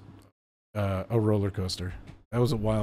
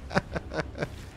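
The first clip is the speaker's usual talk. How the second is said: breathy laugh; 5 semitones higher, 9 dB quieter than usual. Real, not acted. Laughs at the host.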